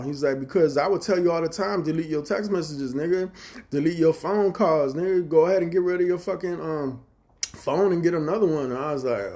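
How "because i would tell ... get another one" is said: brother-in-law lecturing. Retold or imitated.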